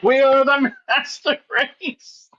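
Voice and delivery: high, drawn-out mock proclamation; mocking grandiose boast. Sending up a friend's master race claim, mock grandiose.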